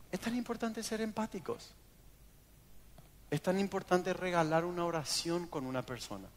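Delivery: bajando mucho la voz, con sinceridad; exhortación tierna. Real, not acted. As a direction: pasa del chiste a un ruego suave y sentido. Tras el chiste, exhortación íntima. (suave, sincero, pastoral)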